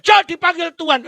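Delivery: loud, high call of the master. Acted. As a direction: call Jo sharply from across the house. Master calling the slave. (panggilan keras sang majikan)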